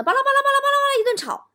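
high, rapid blah-blah; fast high-pitched mimicked rant; mock nagging babble. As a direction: rattle it off like a girlfriend quarrelling. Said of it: "女朋友搁那巴拉巴拉".

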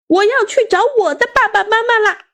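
put-on high-pitched child's proclamation; loud declaration. A narrator's reading. Child packing to leave home, declaring loudly. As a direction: announce it high and insistent. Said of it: "我大声地说".

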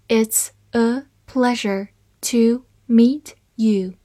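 slow, careful model reading. Shown as a demonstration. Teacher reading the sentence slowly for learners, slow and clear. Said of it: "好，慢慢来读".

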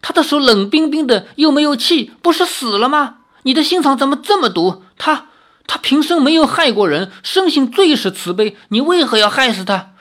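high, anguished wail of protest; bereaved husband, distraught and accusing; grief-stricken cry. Acted. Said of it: "悲哀地叫道".

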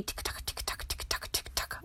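soft, fast whispered hoof sounds; whispered tick-tock. Pretend. Reader whispering the pig's hooves to the children.

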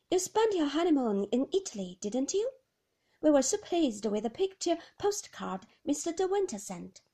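high, chirpy nurse voice; bright cheerful nurse. Acted. The nurse gushes over the honeymoon postcard, high and bright. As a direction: gush politely.

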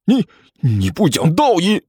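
stammered, frustrated growl; sputtering restrained anger; flustered low roar. Acted. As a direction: choke out an accusation in exasperation. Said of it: "和尚气急败坏地对着齐健低吼了一声".

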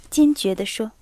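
ordinary delivery

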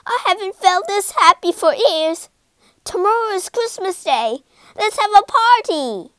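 bubbling over with happiness; overjoyed. A narrator's reading. Mervyn tells the children he wants a party, joyful and quick.

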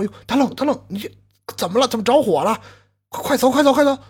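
high, urgent call to flee the fire; urgent shouting neighbour. Acted. Neighbour urging a friend out of a burning house, urgent high call.

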